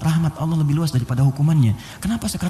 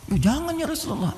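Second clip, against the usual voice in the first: high, soft pleading reply; pleading young man; 14 semitones higher, 4 dB quieter than usual. Retold or imitated. Young man answering the Prophet, high pleading voice.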